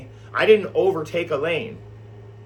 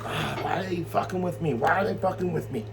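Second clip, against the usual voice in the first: softer, groaning lament; weary groan; 3 semitones higher, 6 dB quieter than usual. Retold or imitated. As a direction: moan it out, worn down and fed up. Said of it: "they're making us groan".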